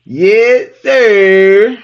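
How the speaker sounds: loud, high, drawn-out call; mock military shout. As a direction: holler it like a playful military response. Playful military reply after Marine joke.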